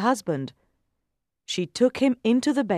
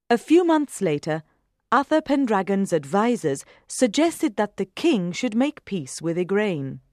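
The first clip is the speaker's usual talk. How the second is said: ordinary delivery, nothing marked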